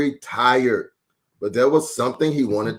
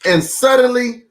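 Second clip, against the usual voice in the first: dramatic suddenly; 10 semitones higher, 4 dB louder than usual. A narrator's reading. Storyteller's dramatic turn.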